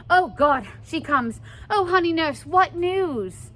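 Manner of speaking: eager. Acted. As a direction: pounce on the nurse for news. Juliet greets the returning nurse.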